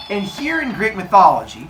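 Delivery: ordinary delivery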